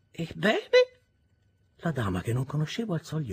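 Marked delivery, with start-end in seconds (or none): 0.1-1.0 high, loud, sadly teasing question; sadly playful. Acted. Miss Havisham prods Pip again, sadly playful. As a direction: tease him with a sad edge.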